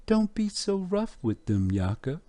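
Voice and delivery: high-pitched imitation of a wife gently scolding; mother's voice. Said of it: "And then a voice comes out".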